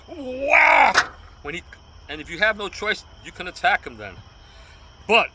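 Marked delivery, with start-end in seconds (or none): 0.1-1.0 sudden loud cry of WHACK, high and hard; a sharp, loud onomatopoeic hit cry; shouted whack sound effect. Pretend. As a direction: bark the impact word out like the cane hitting. Mimics strike during self-defense demo.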